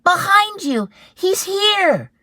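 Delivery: put-on frightened child; panicking. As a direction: warn her in panic. Eddie panicking.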